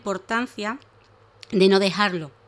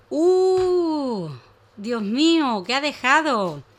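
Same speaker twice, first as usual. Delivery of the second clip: higher, louder gasp of surprise; surprised; 6 semitones higher, 5 dB louder, much slower than usual. Acted. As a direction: gasp and wonder aloud. Narrator builds surprise.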